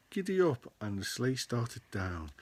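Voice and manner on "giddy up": high, loud giddy-up; Grinch calling out. Acted. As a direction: call it out high to the dog. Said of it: "Then the Grinch said".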